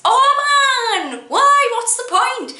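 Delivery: loud, high-pitched complaint; over-the-top pained protest; exasperated wail. Real, not acted. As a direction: wail it in despair at the silliness. Exasperated at the added S.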